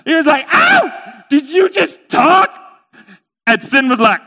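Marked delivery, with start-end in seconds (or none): 0.5-2.5 high, loud, drawn-out disbelief; shocked shout. Acted. Merchant to his talking camel, shocked shout. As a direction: cry it out in comic shock.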